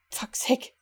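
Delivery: hissed under the breath; an exasperated whispered aside; whispered curse. Real, not acted. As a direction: swear to yourself in a whisper.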